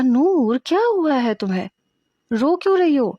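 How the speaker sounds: high, worried questions rising to a cry; startled concern for a sobbing friend; alarmed. Acted. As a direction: friend alarmed at Noor's sudden crying, pitched high. Friend suddenly crying in canteen.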